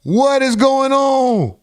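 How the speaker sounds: high, drawn-out bewildered question; baffled disbelief. Real, not acted. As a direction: stretch it out slowly in high-pitched disbelief. Baffled reaction to the played clip.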